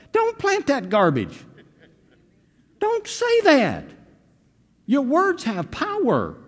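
high, loud exhortation; full-force preaching. Acted. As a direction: drive it home to the congregation.

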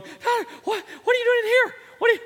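high, loud alarmed shout; startled yell. Retold or imitated. Father waking to find his son, alarmed shout. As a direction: demand an answer in a fright.

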